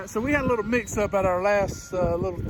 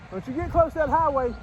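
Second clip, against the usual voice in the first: raised, higher warning call to the dog; 8 semitones higher, 4 dB quieter than usual. Real, not acted. Trucker warning his dog off the highway, higher call. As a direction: call him back from the road.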